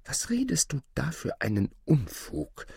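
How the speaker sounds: high-pitched disbelieving mother; incredulous. A narrator's reading. Mother doubting Aladin's tale, incredulous. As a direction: scold the nonsense in disbelief. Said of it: "schüttelte ungläubig den Kopf".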